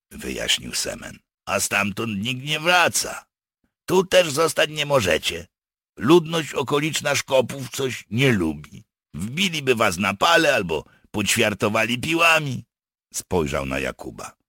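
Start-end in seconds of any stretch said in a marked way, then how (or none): 1.4-12.6 slightly acted, higher explaining voice; warning partisan. Partisan warns captives they cannot stay, explaining. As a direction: spell out the danger, pitched up.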